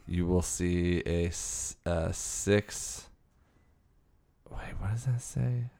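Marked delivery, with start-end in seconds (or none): 4.5-5.7 whispering. Real, not acted. Puzzled aside reading ad.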